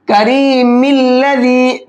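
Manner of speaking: slow chant of करीमिल्लज़ी with meem run straight into lam; joined recitation. Shown as a demonstration. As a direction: recite करीमिल्लज़ी as one word, no alif. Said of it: "फिर कैसे पढ़ेंगे".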